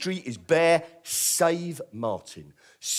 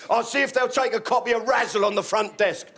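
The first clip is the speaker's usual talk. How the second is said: cheeky mate; 7 semitones higher than usual. Retold or imitated. Martin voiced on phone.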